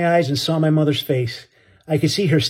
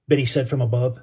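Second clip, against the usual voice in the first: plain reading voice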